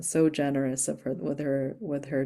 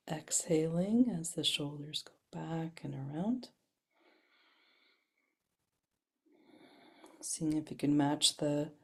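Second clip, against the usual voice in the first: soft, unhurried guided instructions; guided movement; 4 dB quieter than usual. Acted. Breathwork teacher guiding the class through shoulder rolls, softly. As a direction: ease them gently through the shoulder rolls.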